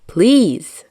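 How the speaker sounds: usual speaking voice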